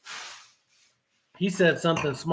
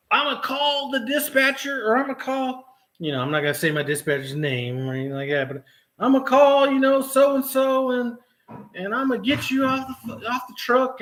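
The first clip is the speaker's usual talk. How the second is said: higher, whiny mock threat; mocking trainer threat; 8 semitones higher than usual. Mocking the trainer threatening to call the dispatcher, higher and whiny. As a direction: parody his threats. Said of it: "he starts going off".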